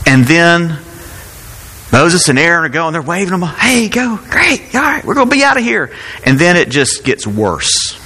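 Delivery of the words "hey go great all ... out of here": mock eager cheer. Moses and Aaron voiced eagerly.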